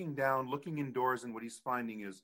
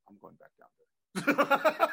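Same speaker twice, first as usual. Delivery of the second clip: defiant laugh; 13 semitones higher, 9 dB louder, slower than usual. Retold or imitated. Brother's defiant quote.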